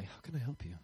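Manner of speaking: soft, sugary customer-service voice; Chick-fil-A politeness. Pretend. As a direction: ask it like an overly polite cashier. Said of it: "It's like Chick-fil-A".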